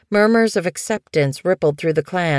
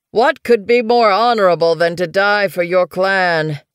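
called out across the clearing, a touch louder; calling out; 3 semitones higher, 5 dB louder than usual. A narrator's reading. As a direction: call it out from a distance. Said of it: "Weed Whisker called from the nettle patch".

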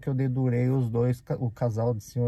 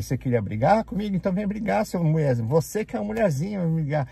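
raised, pitched-up taunting of a beaten rival; retold angry taunt; 5 semitones higher, faster than usual. Speaker retelling daring the bloodied coworker to fight, high and heated. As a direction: goad him to come fight, heated.

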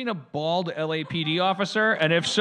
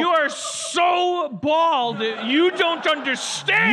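high, loud, slow mock yell; hammed-up shouted taunt; 11 semitones higher, 6 dB louder, slower than usual. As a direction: bellow it like a ridiculous accusation. Friend joking in loud voice.

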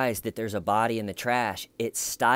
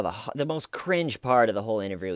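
ordinary delivery

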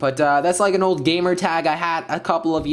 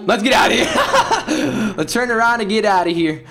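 laughing retreat; 9 semitones higher, 4 dB louder than usual. Real, not acted. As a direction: run off giggling. Fleeing, laughing.

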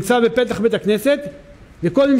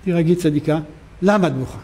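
lower, calming voice; soothing; 5 semitones lower, 3 dB quieter than usual. Acted. Man calms the crying little girl, soothing. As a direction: gently settle a crying child. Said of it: "תירגעי, ילדה".

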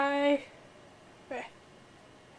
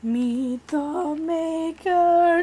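slow dramatic; 5 semitones higher, 3 dB louder, much slower than usual. Acted.

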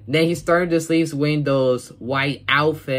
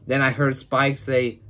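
ordinary talk

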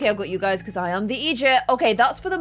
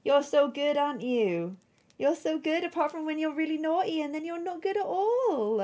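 cooing to dog; 6 semitones higher, 4 dB quieter than usual. Real, not acted.

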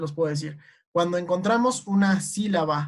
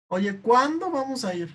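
everyday question, CUÁN-do stressed high; acento natural de cuándo; 6 semitones higher than usual. Shown as a demonstration. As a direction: ask it naturally, peaking on "cuándo". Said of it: "¿Dónde estará?". (pregunta natural, con acento en CUÁN)

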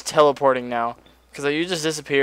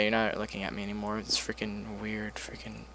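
whispered aside; 4 semitones lower, 14 dB quieter than usual. Real, not acted. Creeped out by the lady.

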